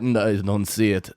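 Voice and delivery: low, glum mock Russian accent; lightly voiced gloomy Russian-accented clerk; Russian Eeyore. Clerk to caller about a missing order, glum Russian accent. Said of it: "Russian Eeyore".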